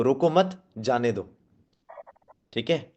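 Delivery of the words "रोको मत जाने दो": रोको मत as one unit, then जाने दो dropped; pause after मत. Shown as a demonstration. Trainer showing the same line reversed in meaning, pause after मत. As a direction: break after मत, let जाने दो fall. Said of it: "जाने दो में डाउन कर लिया".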